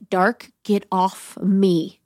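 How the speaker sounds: very slow, drawn-out command; mock repelling. Woman telling the darkness to get off her, repelling. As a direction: stretch each word, pushing it away. Acted pushing away the suffocating dark.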